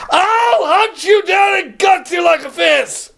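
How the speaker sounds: high, laughing shouted threat; sudden shout. Retold or imitated. Vlogger retelling the Grinch's threat, in a laughing shout. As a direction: burst into a cackling yell. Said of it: "He goes".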